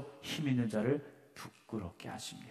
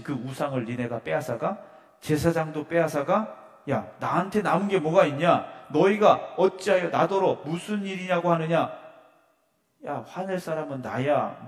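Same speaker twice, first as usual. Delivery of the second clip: loud, higher, outraged protest; indignant outburst; 3 semitones higher, 7 dB louder than usual. Retold or imitated. Micah protesting to the Danites who took his idols, loud and indignant. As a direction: Micah shouting that his gods were stolen. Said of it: "미가가 말하고 있는 거예요".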